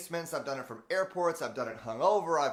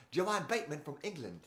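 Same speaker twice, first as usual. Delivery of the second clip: light put-on English accent; a hint of an English accent; 3 dB quieter than usual. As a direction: slide into a playful British voice. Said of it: "a good transition between Australia and England".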